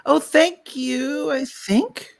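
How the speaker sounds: high, slow, doubtful "thank you"; hesitant, half-questioning gratitude; unsure thanks. Retold or imitated. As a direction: drag out a puzzled thanks. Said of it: "you have to think twice and go".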